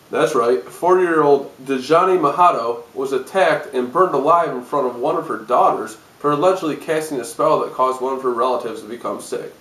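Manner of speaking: usual speaking voice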